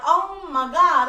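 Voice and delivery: high, loud, slow re-enacted gasp of disbelief; retold astonishment. Widow relives the coincidence with the athlete, astonished. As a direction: stretch it out, reliving the shock.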